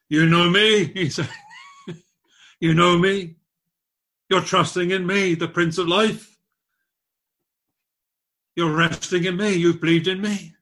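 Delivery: voicing Jesus from the pulpit; preaching Christ's words. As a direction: proclaim it as Jesus speaking.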